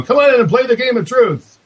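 bold showman calling in a crowd; loud carnival barker. Shown as a demonstration. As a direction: step right up, sideshow style. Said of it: "start off kinda like a carnival barker".

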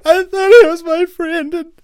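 high, loud mock sobbing; hammed-up crying. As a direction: wail like a jilted friend.

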